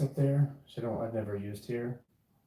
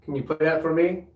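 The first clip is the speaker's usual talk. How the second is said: loud, raised voice calling upward; 6 semitones higher, 8 dB louder than usual. Real, not acted. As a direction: call out to the spirits. Calling out to spirits.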